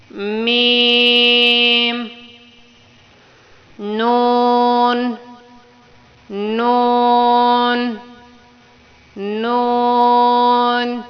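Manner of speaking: each letter held very slowly for repeating; drawn-out letter drill. Shown as a demonstration. Teacher drilling letters for pupils to echo. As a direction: stretch every letter long. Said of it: "میرے پیچھے repeat کرنا ہے".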